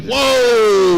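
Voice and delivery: a mocking "Whoa" shouted high and loud. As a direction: throw out a big, high, loud mock whoa. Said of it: "He said".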